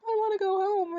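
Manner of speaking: high, loud choked-up wail, retold; hammed-up tearful plea, pitch way up. Woman to her date after dinner, re-enacted choked up. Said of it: "And I just choked up".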